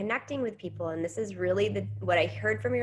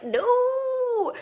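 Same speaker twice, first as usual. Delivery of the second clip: high, loud mock gasp of dismay; hammed-up high wail of no; mock horrified no; 4 dB louder than usual. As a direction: cry out in exaggerated shock. Everyone reacting to Facebook post.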